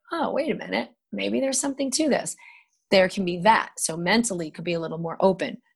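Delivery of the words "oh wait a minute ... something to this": higher, intrigued realization; dawning realization. Retold or imitated. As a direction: catch yourself realizing something. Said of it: "now you're like".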